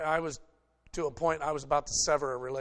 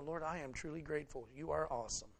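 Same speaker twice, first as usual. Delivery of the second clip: hushed prayer; 9 dB quieter than usual. Retold or imitated. Pastor voicing his own prayer.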